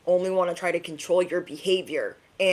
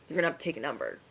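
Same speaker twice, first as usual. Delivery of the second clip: tag dismissal; 3 semitones lower, 7 dB quieter, much faster than usual. Acted. Dismissing haters, first take.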